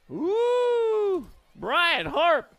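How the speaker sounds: high, loud rising finish call; excited finish call. Real, not acted. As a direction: shout the close finish at the line. Close finish at the line.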